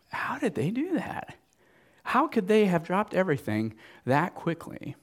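hushed, breathy wondering question; whispered question. Pretend. Pastor voicing listeners' puzzled question in a whisper. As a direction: lean in and whisper the doubt.

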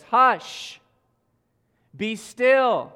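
loud, high command over the storm; commanding shout. Acted. Jesus rebukes the raging storm with a loud command. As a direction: order the wind to stop, full force.

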